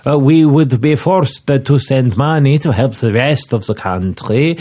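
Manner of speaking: put-on a pompous Milanese inspector in an Italian accent. Inspector introduces himself to Scotland Yard, Italian accent. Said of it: "to do the accent".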